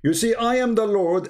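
usual speaking voice

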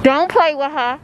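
hint of a New York accent. Shown as a demonstration. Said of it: "You heard my New York accent".

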